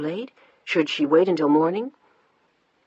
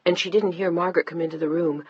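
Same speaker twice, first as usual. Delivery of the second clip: usual speaking voice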